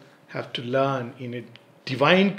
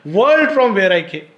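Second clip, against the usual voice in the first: loud, high, awestruck quoting; emphatic retelling; 5 semitones higher, 8 dB louder than usual. Retold or imitated. Parent repeating a five-year-old's words, emphatic wonder. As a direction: hammer home the child's words, punching WORLD.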